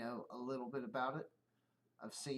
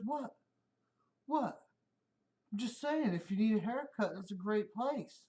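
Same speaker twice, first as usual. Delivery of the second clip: lightly imitated buddy, higher and innocent; buddy's puzzled voice; 6 semitones higher than usual. Coworker defends his barber tip to the bald driver, higher and innocent. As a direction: play the coworker as innocently confused. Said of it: "he looks at me and goes".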